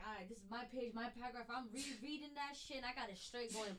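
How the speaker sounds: soft thinking to oneself, retold; muttered inner thought. Student silently plans their paragraph, soft muttered thought. Reading ahead to oneself in class.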